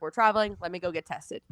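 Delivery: ordinary talk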